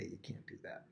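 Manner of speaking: drops to a near-silent whisper; sheepish whisper. Retold or imitated. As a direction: whisper it like embarrassing news. Nathan sheepishly breaks the news.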